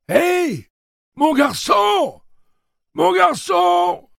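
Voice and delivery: very high, loud, slow calling out; old man yelling. Acted. Old man calling Jake back, high loud yell. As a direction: shout after the boy down the street. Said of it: "l'autre hurla".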